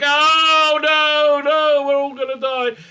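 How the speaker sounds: high, loud hammed-up wail of doom; mock panic. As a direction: overplay the terror of certain death. Going through the wrong door.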